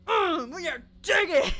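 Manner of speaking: loud grunting, hammed-up effort; intense miner. Streamer mimicking first-person mining, intense grunting. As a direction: grunt it out like hard digging, laughter creeping in. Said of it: "first person mine is like".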